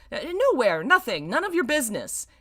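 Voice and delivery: embarrassed stammering brush-off; flustered stammer. Acted. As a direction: trip over the first word, then rush the denial. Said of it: "tried to stammer something resembling words".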